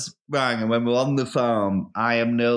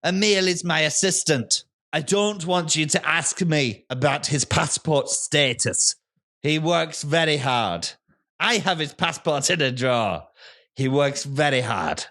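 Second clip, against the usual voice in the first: busy Irish-sounding farmer; 3 semitones higher than usual. Acted. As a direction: shoo the visitor off while barking farm chores in an Irish-sounding accent. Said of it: "what part of Ireland are you from".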